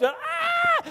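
high panicked "ah!"; yell. Retold or imitated. Both men dangling from one limb, high panicked yell. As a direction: let out a high falling-man yell. Both hanging, hollering in fall.

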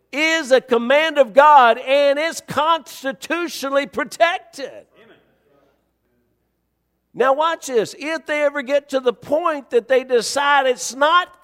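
forceful preaching. Real, not acted. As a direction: drive it home to the congregation.

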